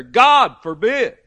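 "God forbid" slow, loud, with a hard push on "God"; strong emphasis. Shown as a demonstration. Preacher showing the congregation the phrase's force, loud and slow. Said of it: "so that we would get the strength of it".

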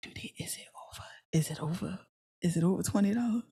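secretive hushed question; whispering. Shown as a demonstration. Checking the bill before paying for strangers, whispering. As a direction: whisper it, easing into a soft murmur. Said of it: "'cause sometimes you gotta whisper".

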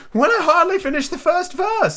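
very high, slow, wailing Hatter; Hatter character voice. Acted. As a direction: stretch it out high and theatrical.

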